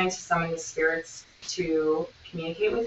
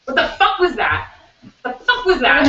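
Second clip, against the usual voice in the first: higher-pitched alarmed demand to know; retold shock, pitched up; startled outburst; 8 semitones higher, 4 dB louder than usual. Friend bolting up in the shared bed, retold startled.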